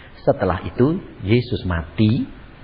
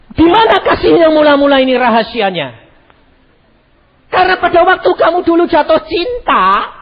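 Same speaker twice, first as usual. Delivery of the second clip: loud, high preaching call; fiery sermon voice; 14 semitones higher, 7 dB louder than usual. Acted. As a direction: preach it out to the hall. Preaching at full force.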